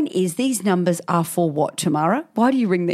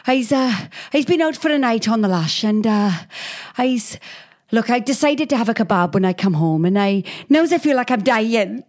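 hammed-up Welsh caller, a touch higher; mock Welsh accent; 4 semitones higher than usual. Overfull man phoning for an ambulance, mock Welsh lilt. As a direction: put on a sing-song Welsh lilt.

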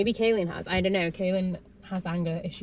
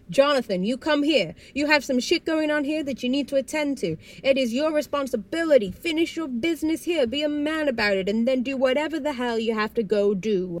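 higher-pitched mock scolding, pressing on; telling off; 6 semitones higher, 4 dB louder than usual. Recapper voices the mother full-naming her son, scolding. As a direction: imitate a mother laying down the law. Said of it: "She full names him again".

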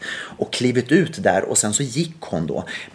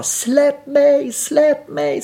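shrill high retold scream of release me; girl screaming; much slower than usual. Girl on the bridge railing screaming at rescuers, high desperate scream. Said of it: "hon skriker bara".

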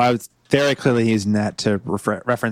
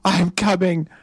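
higher, slow and breathless; hammed-up fleeing groom; 7 semitones higher, slower than usual. Groom running to his princess, higher and panicked. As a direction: play the panicked groom in a high voice.

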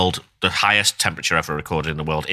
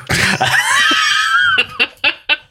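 big loud laugh; loud laughing burst; 6 dB louder than usual. Real, not acted. Laughs at accent attempt.

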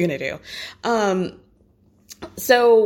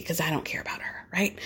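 quick, catty whisper, almost unvoiced; a sly whisper the others shouldn't hear; whispered aside; 3 semitones lower, 11 dB quieter than usual. Sierra slipping in a sly aside about Lindsay, whispered fast.

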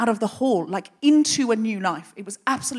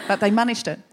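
ordinary delivery

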